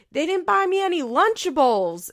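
put-on high, loud child's complaint; whiny kid voice. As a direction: whine it like a hungry little girl.

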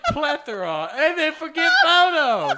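high, loud, laughing through the words; laughing disbelief. Real, not acted. Mocking co-host's forgotten word, laughing loud. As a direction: crack up while teasing her.

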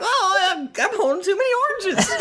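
very high, goofy character voice; comic high-pitched entrance, near laughter; Toby's voice. Acted. Player interrupts the sad scene as a bumbling character, high-pitched. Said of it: "And Toby walks in like".

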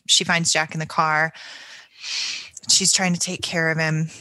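ordinary delivery

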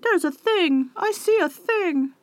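high dog voice, slow on the second line; dog barking. Retold or imitated. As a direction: play an excited dog spotting something. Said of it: "barking loudly".

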